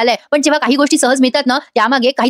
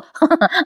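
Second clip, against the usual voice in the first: light laugh; 6 semitones higher than usual. Real, not acted. Narrator laughs at herself.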